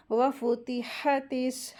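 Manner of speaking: वफ़ुतिहतिस slow, ending on a held s; careful recitation. Shown as a demonstration. Teacher models short vowels, measured वफ़ुतिहतिस with held s. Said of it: "हा के लिए हम्स के साथ".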